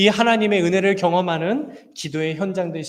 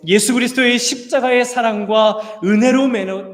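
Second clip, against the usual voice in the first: loud, raised preaching voice; impassioned preaching; 4 semitones higher, 7 dB louder than usual. Real, not acted. Preacher telling the congregation why they are family, impassioned. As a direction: drive the point home to the congregation, loud and high.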